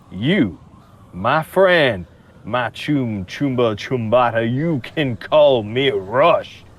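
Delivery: drawn-out, courtly Southern introduction; Southern drawl. Acted. Smooth talker introducing himself to the local boss, Southern drawl. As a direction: lay on the Southern charm, savoring the name. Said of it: "this guy sounds more like me than I do".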